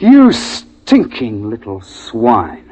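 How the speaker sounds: slow, seething insult; cold contempt. Acted. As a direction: spit each word out slowly. Confronting the coward.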